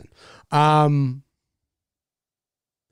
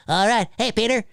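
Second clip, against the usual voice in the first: higher cartoon-neighbour voice; Quagmire; 5 semitones higher than usual. Shown as a demonstration. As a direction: call out with high vocal placement. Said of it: "He goes up here".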